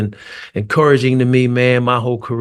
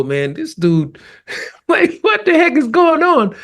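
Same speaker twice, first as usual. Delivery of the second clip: high, laughing disbelief; half-laughing, baffled by the bad shooting; incredulous. Player baffled at stranger's missed shots, high laughing disbelief. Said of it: "right when I'm about to go".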